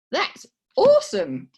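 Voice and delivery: high, loud, slow Australian "awesome"; Aussie accent. Retold or imitated. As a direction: copy the Aussie women in the office, loud and slow. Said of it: "a little bit of an Aussie twang".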